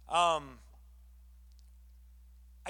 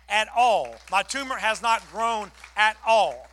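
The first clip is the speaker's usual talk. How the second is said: louder, stressing at all; joyful emphasis; 2 semitones higher, 9 dB louder than usual. Retold or imitated. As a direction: Linda telling her good news, louder and joyful. Linda's good news emphasized.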